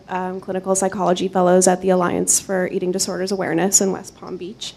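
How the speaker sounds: normal talking voice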